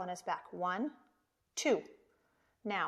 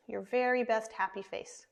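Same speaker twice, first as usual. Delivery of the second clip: ordinary delivery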